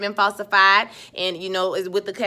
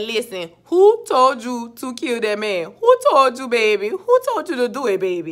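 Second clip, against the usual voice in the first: mock threatening interrogation; 3 semitones higher, 4 dB louder than usual. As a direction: act out a threat, leaning hard on each question. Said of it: "He threatened her child and he said".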